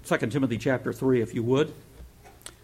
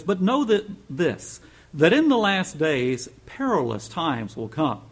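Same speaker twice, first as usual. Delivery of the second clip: scripture reading; 3 semitones higher, 4 dB louder, slower than usual. Acted. Reads scripture aloud.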